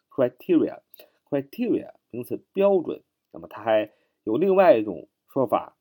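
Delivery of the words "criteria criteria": "criteria" stressed on TEE, cry-TEE-ree-uh; stress on second syllable. Shown as a demonstration. Teacher showing where the stress falls, "criteria" with TEE stressed. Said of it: "重音在T那儿啊".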